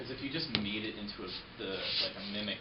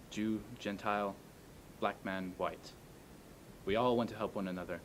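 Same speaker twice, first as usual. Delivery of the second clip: formal speech. Acted. Recites Chaplin's speech calmly.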